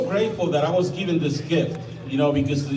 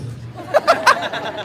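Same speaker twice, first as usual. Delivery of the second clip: breathy laugh. Real, not acted. Laughing at own accent joke.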